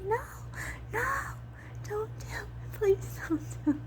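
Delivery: high, teary plea; pleading not to. Retold or imitated. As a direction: beg them, voice close to breaking. Retold pleading with the game.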